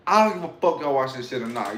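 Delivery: usual speaking voice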